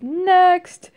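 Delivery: loud, high snappy call; cocky win shout. Retold or imitated. Reviewer imitating Marno's win quote, loud and high. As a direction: bark it out like a confident winner. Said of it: "her win quote is".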